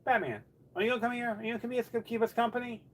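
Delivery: very high, fast coaxing voice. Real, not acted. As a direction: sweet-talk the cat over. Coaxing the cat.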